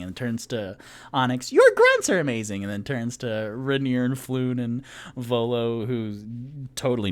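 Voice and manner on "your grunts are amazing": high, loud tipsy compliment; gushing praise. Acted. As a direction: squeal it at the half-orc. Tipsy turns to Onyx.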